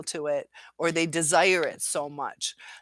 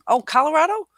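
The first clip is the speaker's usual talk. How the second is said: surprised question; 10 semitones higher, 9 dB louder, faster than usual. Real, not acted. Checking a chat detail aloud.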